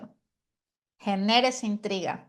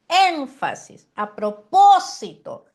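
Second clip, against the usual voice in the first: énfasis said slowly, high and loud; stressed word; 5 semitones higher, 8 dB louder, slower than usual. Shown as a demonstration. Preaching teacher demonstrates emphasis on énfasis, punched loud and slow. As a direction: lean hard on énfasis. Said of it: "énfasis en ciertos puntos".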